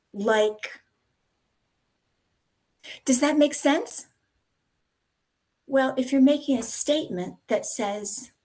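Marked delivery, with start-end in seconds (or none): 3.1-4.1 "sense" lifted high at the end; question on an up sound. Shown as a demonstration. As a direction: finish on a rising, questioning note. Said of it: "finish the sentence on an up sound".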